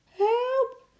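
high, loud panicked scream; screaming for help. A narrator's reading. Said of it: "Ze gilde zo hard ze kon". (hoog, luid gillen om hulp)

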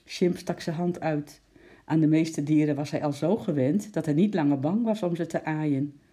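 usual speaking voice

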